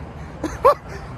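high breathy nervous laugh. Real, not acted. Lost rider laughing nervously to viewers, high nervous laugh. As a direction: laugh it off while lost and uneasy.

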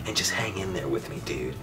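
soft whispered appeal; hushed whisper. Real, not acted. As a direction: lean in and whisper to keep them watching. Hushed plea to viewers.

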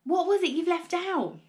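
urgent pressing. A narrator's reading. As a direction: push him hard to remember. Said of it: "cried Mister Cranky".

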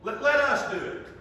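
loud, high insistent offer; urgent plea. Retold or imitated. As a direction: push hard to take over. Staff insisting again.

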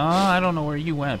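ordinary talk, nothing marked

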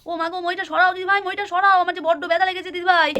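acted wail of pain; hurt child wailing. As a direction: plead for help as a hurt little one. Hurt child wails to elder sibling.

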